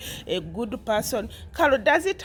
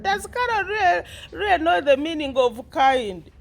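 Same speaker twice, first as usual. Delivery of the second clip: high amused rhetorical question; laughing disbelief; 6 semitones higher than usual. Retold or imitated. Vlogger mocking Carol to viewers, amused and high. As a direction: ask it mockingly, pitched up. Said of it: "I was like".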